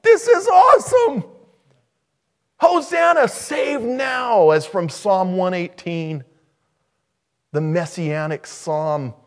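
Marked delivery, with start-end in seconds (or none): 0.0-3.3 very high, loud cheering; childlike excited shouting, pitched way up; imitated excited kids. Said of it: "the kids are just like". 3.3-4.5 high, loud shout of SAVE NOW; "save now" shouted high and drawn out; children's cry. Retold or imitated. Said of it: "the kids are just like".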